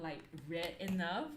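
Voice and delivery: ordinary talk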